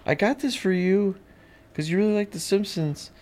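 high, sweet imitation of a girlfriend; imitating girlfriend. Quoting Lauren giving a gift, high imitating voice. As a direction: lift the voice to play her. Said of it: "she's like".

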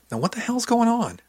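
high, rising exasperated question; exasperated demand. A narrator's reading. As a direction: demand to know, voice climbing. Friend demands an explanation.

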